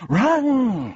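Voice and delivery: high, loud cry to run; urgent urging. A narrator's reading. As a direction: call out long and urgently. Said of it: "it urged once more".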